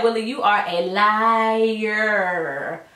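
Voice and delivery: slow, drawn-out scolding; mock scold of a TV character; calling him a liar. Real, not acted. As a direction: stretch out the accusation, very slow. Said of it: "he lied his butt off".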